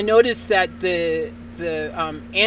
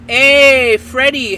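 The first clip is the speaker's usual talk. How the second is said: loud, drawn-out, higher hello; calling out greeting; 4 semitones higher, 9 dB louder, much slower than usual. Real, not acted. Caller greeting Freddie as the call connects, loud drawn-out greeting. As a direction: hail him loudly, stretching the hey.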